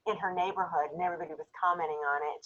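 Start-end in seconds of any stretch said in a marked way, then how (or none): none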